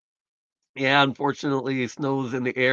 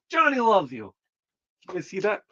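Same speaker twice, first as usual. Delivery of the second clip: talking to the dog; 6 semitones higher than usual. Real, not acted. Owner telling his dog a viewer loves her, high cooing. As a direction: coo it at the dog.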